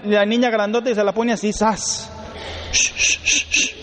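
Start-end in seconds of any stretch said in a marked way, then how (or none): 2.7-3.7 hushing shh. Retold or imitated. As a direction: hush the baby to calm it. Mother hushing the child.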